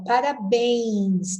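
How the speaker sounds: parabéns slow, a little I inside béns; nasal glide demo. Shown as a demonstration. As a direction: stretch the final béns of parabéns into "bẽins". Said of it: "tem um Izinho aí". (parabéns devagar, com um izinho no final)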